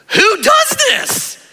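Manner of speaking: high, drawn-out shout of disbelief; incredulous outcry. Acted. Stunned sermon audience reacting to Jesus's teaching, incredulous shout. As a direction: shout it slowly, voice leaping way up. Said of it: "people are all left sitting there going".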